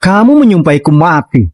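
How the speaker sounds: ordinary talk, nothing marked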